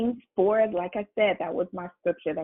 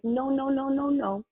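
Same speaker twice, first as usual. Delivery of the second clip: higher-pitched string of quick no's; mock refusal; 6 semitones higher than usual. Preacher answering people who just wait on God, mock refusal. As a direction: chant the no's on one raised note. Mimics refusing a passive attitude.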